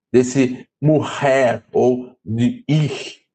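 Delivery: morrer and ir said very slowly, drawing out the R sound; example words. Shown as a demonstration. Teacher giving a sample word for the rho sound. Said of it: "vai ter esse som de".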